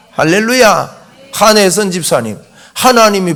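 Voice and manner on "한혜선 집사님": ordinary delivery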